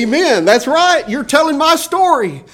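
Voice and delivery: high, bright agreement; eager amen. Pretend. A listener answering the preacher with an excited high amen. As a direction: cry out amen like a moved listener.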